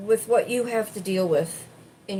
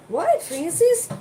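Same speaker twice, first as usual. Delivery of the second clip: calling to pet; 12 semitones higher, 5 dB louder, slower than usual. Real, not acted.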